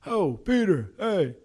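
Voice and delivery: high, loud call-out as a little Italian guy; Frankie. Retold or imitated. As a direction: holler for a teammate, imitating an excitable Italian. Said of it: "Frankie is a little Italian guy".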